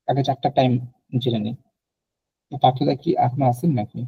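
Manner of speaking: ordinary talk, nothing marked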